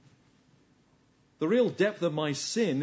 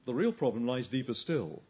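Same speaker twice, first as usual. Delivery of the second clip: usual speaking voice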